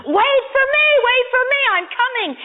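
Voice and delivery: high-pitched, urgent storyteller's yell; acted screaming. Boy running after the moving train, mock screaming. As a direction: cry out after a departing train. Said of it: "screaming at the top of his lungs".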